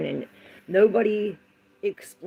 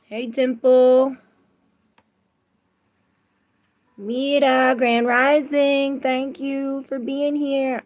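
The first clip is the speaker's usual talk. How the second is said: sing-song greeting; 7 semitones higher, 5 dB louder, slower than usual. Real, not acted. Greeting viewers.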